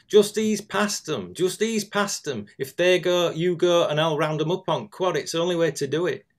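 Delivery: loud, high shout across the lane; farmer shouting. Retold or imitated. Farmer shouting to a passing driver, loud and high. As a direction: call it out over the cows and mud. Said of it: "he shouts to me as I pass".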